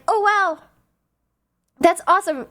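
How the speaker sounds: lightly acted awkward date reaction; nervous date. Imagining a first date over food, mock nervous. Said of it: "so nervous trying to talk".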